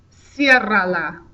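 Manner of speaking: "ciérrala" slow, stress on the first syllable "cié"; teacher showing where the stress falls; Spanish pronunciation. Shown as a demonstration. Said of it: "I put the accent mark on the third to last syllable".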